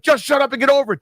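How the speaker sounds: mock yell. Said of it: "they'll yell".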